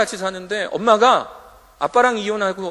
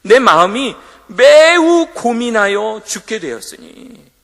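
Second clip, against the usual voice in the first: slow, much louder, higher anguished line; anguished recitation; 6 semitones higher, 14 dB louder, slower than usual. Acted. 설교자가 성경 구절을 괴롭게 크게 외치며 반복. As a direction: cry out the verse slowly.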